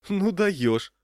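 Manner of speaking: high, loud, amused exclamation; amused disbelief, pitched high; amused waitress. A narrator's reading. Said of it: "рассмеялась Леночка".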